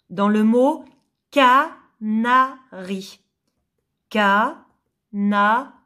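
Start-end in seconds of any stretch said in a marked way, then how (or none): 1.3-3.2 slow "canari" in three separate beats; syllable by syllable. Shown as a demonstration. Teacher introducing the word to pupils, "canari" syllable by syllable. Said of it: "Si je supprime la première syllabe". 4.1-5.7 very slow "canari", long gaps between syllables. Shown as a demonstration. Teacher repeating for pupils, "canari" syllable by syllable. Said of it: "Si je supprime la première syllabe".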